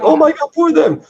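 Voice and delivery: very high squeaky whimper of pity; mock pity. Dante pitying the sinners, hammed-up mock pity. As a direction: gush with pity in a tiny high voice.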